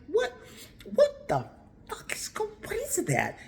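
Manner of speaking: jumps high, half-whispered disbelief; gasping at something weird in the distance; stunned what. Retold or imitated. Driver spotting a moving sign pile, reenacting shocked disbelief. Seeing a strange moving glob.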